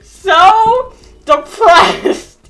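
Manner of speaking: words squeezed out through laughter; can't hold the laugh back. Real, not acted. Narrating the sad part while cracking up, laughing.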